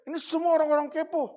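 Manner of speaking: high-pitched retold grumble; resentful complaint. Resentful mother complaining about nosy neighbours, high-pitched grievance. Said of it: "mama saya tuh dendam sekali".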